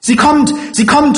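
very high, loud shriek; terrified child's cry. Acted. Child sees the woman coming, terrified high cry. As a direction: cry out in alarm, pitched right up. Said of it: "schrie das Kind".

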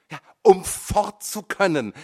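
high, loud; angry retort. A narrator's reading. Son retorts to his mother, angry. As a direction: hit back hard.

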